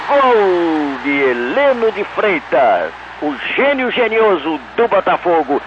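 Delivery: veteran radio goal narration; radio play-by-play. Shown as a demonstration. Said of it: "Faz pra nós, Mendes".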